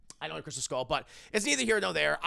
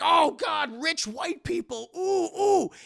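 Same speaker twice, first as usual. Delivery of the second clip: mock begging villagers; 12 semitones higher, 7 dB louder, slower than usual.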